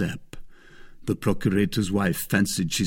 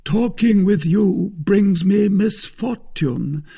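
slow, loud, high-pitched rebuff; aggrieved Musketeer; 11 semitones higher, 6 dB louder, slower than usual. A narrator's reading. Porthos rebuffs the pleading wife, aggrieved. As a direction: push away with lofty reproach.

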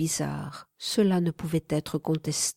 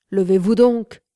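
louder gruff soldier command; old Cossack; 7 dB louder than usual. A narrator's reading. Old Cossack servant waking his master, loud order. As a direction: bark the order like an old trooper. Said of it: "la forte voix de buveur d'eau-de-vie".

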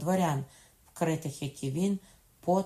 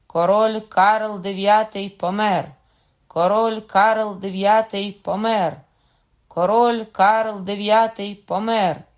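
high, very loud, slow herald's cry; shouted proclamation; 5 semitones higher, 14 dB louder, slower than usual. A narrator's reading. Guard captain announces king's death, shouted proclamation. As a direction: proclaim each word ringingly from a balcony. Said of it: "прокричав тричі".

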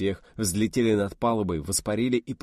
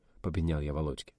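usual speaking voice

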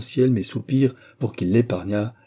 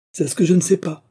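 sharp retort; 8 semitones higher, 4 dB louder than usual. A narrator's reading. Innkeeper's wife retorts to husband.

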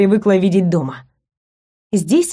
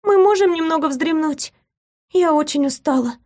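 put-on tired girl; 8 semitones higher than usual. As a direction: ask weakly, worn out. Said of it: "слабо позвала Рине".

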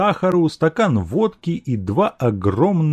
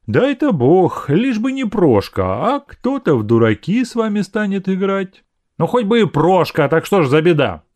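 higher-pitched spiteful jibe; 8 semitones higher than usual. A narrator's reading. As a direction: needle him with mild spite. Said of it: "со злостью заметила она". (со злостью)

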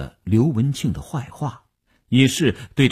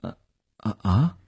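soft, hesitant stammering; unsure, halting murmur; at a loss; 4 semitones lower, 4 dB quieter than usual. A narrator's reading. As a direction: fumble quietly for words. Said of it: "不知该如何说似的".

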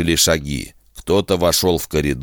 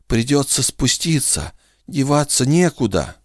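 higher, shaky frightened whisper; trembling whisper; 8 semitones higher than usual. A narrator's reading. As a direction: whisper to the dog in terror. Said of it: "дрожащим голосом прошептал".

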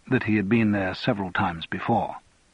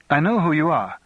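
put-on light Scottish Lowland accent, pitched higher; 5 semitones higher, 3 dB louder than usual. A narrator's reading. Daughter challenging Andrew on being introduced, light Lowland accent. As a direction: give her a light Scottish Lowland lilt. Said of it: "in a light lowland accent".

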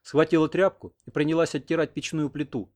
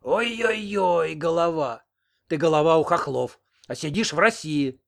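mocking; 4 semitones higher, 4 dB louder, slower than usual. A narrator's reading. Old woman mocks the headman.

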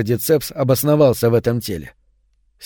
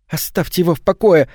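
plain reading voice

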